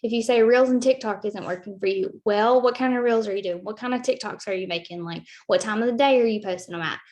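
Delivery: ordinary delivery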